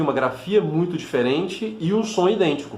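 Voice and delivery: usual speaking voice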